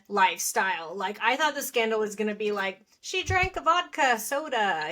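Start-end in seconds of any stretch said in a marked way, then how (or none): 3.0-4.9 higher-pitched pretend shock; mock scandalised. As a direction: gossip it as if it were a terrible sin. Mock scandalised guess at gossip.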